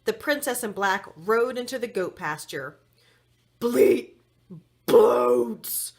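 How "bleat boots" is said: loud, gurgling monster voice; monster gurgle. A narrator's reading. The monster gurgles at the goat boy, loud and thick. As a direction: gargle it like a slimy beast. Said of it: "the monster gurgled".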